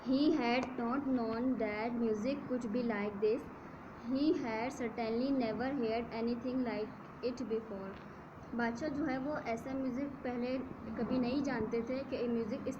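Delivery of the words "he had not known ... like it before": slow, measured textbook reading; story read-aloud. A narrator's reading. Teacher reads English story to students, slow measured read-aloud. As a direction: read it out phrase by phrase.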